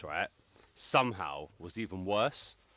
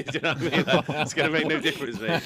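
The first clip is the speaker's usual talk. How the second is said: laughing; 6 semitones higher, 6 dB louder than usual. Real, not acted. Joking punchline while laughing.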